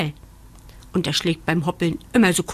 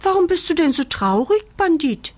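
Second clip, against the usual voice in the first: high, quick bunny voice; inquisitive rabbit; 9 semitones higher, 4 dB louder than usual. A narrator's reading. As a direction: ask eagerly as a little rabbit. Rabbit asks sad puppy.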